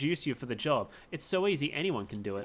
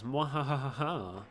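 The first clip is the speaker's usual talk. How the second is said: slow evil laugh; 3 semitones lower, slower than usual. Acted. Villain laugh.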